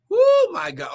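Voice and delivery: high, loud shout of praise; ecstatic woo. Real, not acted. Preacher caught up in praise, ecstatic cry. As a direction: burst out in joy.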